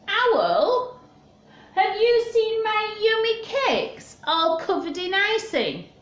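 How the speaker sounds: high, anxious bear calling to his friend; worried bear. Acted. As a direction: ask in a high, fretting bear voice. Bear asks Owl.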